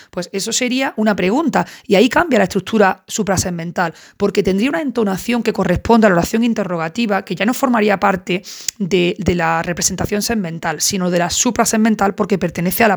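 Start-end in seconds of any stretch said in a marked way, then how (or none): none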